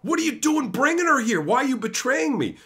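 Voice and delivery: high, alarmed protest; rattled creature crying betrayal, voice pitched high; panicked accusation. Retold or imitated. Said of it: "Urabrask is also freaking out".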